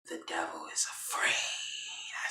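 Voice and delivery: low, breath-only whisper; menacing whisper. Retold or imitated. Catherine looking in Veronica's face, menacing whisper. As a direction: whisper it like a quiet threat.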